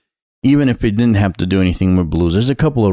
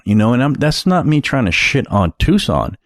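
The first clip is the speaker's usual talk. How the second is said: usual speaking voice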